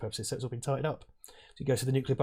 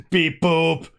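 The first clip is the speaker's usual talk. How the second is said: playful beep boop robot noise; 4 semitones higher, 6 dB louder than usual. Pretend. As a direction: chirp it like a little robot. Joking about robot power cell.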